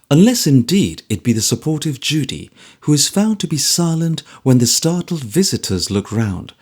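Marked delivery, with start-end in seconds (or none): none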